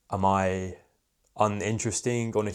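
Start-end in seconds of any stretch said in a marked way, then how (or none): none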